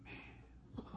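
hushed, whispered amazement; voiceless breath of awe; awed whisper. Retold or imitated. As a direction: whisper it in wonder. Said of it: "I'm like this going".

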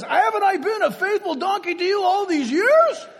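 high, laughing, hurt talking-horse plea; Mister Ed voice. Retold or imitated. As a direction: whine it like a loyal horse wronged. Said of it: "like Mister Ed".

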